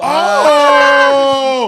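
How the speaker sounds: loud, high hype shout; excited shout. Real, not acted. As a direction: yell it out in excitement. Hyped reaction to the new car.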